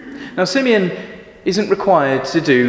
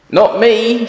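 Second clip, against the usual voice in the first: high, loud sheep's refusal; 10 semitones higher, 5 dB louder than usual. Acted. Sheep refusing Mister Gumpy, high and loud. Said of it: "says the sheep".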